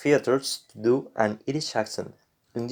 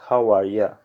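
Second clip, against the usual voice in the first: Irish "How are ya?", lower and ending on ya; Irish accent; 4 semitones lower than usual. Shown as a demonstration. Teacher showing learners a greeting, Irish accent. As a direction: say it the Irish way, "ya" for you. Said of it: "in an Irish accent".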